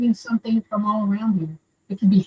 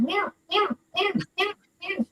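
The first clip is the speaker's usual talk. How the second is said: high, soft meowing like a distressed cat; imitating the cat crying meow in the bundle; 12 semitones higher, 8 dB quieter than usual. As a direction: mew it high and thin like a lost cat. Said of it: "he was going".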